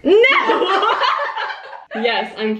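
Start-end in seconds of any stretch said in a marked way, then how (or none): 0.0-1.1 high laughing "no"; laughing denial. Real, not acted. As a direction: burst out laughing in protest.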